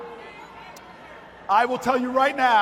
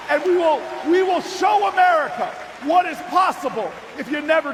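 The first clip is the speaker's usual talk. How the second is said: rising, louder call to the crowd, building; rally the room, each line higher; rousing rally cry; 3 semitones higher, 3 dB louder than usual. Acted. Rallying chant in the speech.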